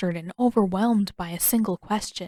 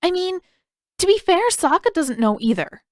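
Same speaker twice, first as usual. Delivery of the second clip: put-on high, louder defensive boyish voice; sheepish Aang; 10 semitones higher, 6 dB louder than usual. Aang embarrassed, defending himself to Katara high-pitched. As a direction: squirm out of blame.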